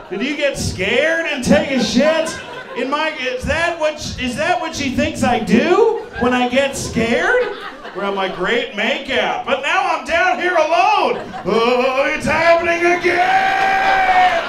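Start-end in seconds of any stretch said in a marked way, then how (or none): 0.1-7.4 high-pitched, incredulous shouting; indignant disbelief. Acted. Man rants about what she thinks he does, high and indignant. Indignantly repeats her question back. 8.4-14.4 very high, drawn-out panicked cry; mock terrified wail. Man left alone downstairs after makeout, wailing in mock fear.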